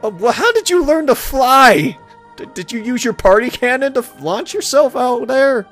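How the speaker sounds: high-pitched, incredulous questioning; mock bewilderment. Pony baffled by Pinkie's flying, incredulous. Reader acts pony's bewildered reply.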